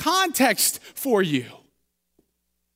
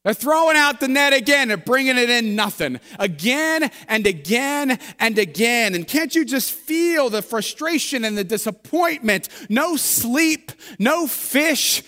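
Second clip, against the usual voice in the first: ordinary talk, nothing marked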